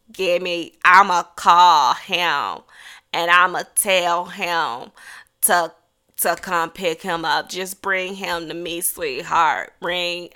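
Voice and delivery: slow, low, halting grandmother; drawling Nana. Retold or imitated. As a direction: take your time, low and sweet, as Nana. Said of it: "she talk like that".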